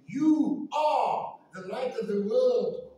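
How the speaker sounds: loud, slow, commanding proclamation; emphatic exclamation. Retold or imitated. Preacher voices God sending his servants, emphatic and commanding. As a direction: drive it home word by word. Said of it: "It was an emphatic exclamation".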